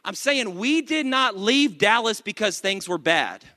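ordinary delivery

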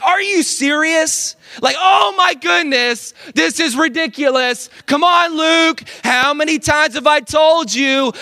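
high, loud exasperated scolding; berating rant. Acted. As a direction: yell at the kid like a fed-up dad. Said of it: "berate my son".

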